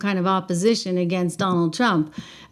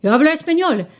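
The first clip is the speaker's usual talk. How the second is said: Spanish; 7 semitones higher, 4 dB louder, faster than usual. Shown as a demonstration. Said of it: "I can speak Spanish with a Spanish accent".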